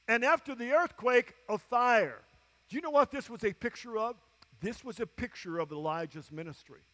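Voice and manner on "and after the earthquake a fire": usual speaking voice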